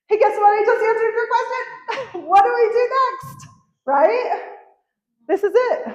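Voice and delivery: high, loud, giddy teasing; delighted at the coincidence; bubbling over with glee; excited, laughing. Real, not acted. Excited joking to Paula.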